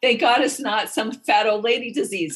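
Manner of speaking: loud, high, hammed-up relief; exclaimed relief. Patient hearing it is only her appendix, exclaiming in relief. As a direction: retell it as a big outburst of relief.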